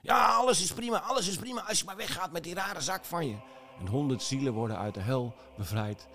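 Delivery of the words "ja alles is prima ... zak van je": high squeaky devil yelling; small demon at the gate shrieking him away; shrieking little demon. Acted. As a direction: shriek like a tiny red imp. Demon gives in.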